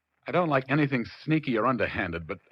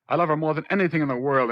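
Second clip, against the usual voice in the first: higher and louder, a heartfelt cry; passionate outburst; 5 semitones higher, 5 dB louder, faster than usual. Acted. Husband telling the detective he loves Peggy, passionate outburst. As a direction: burst out with all his feeling.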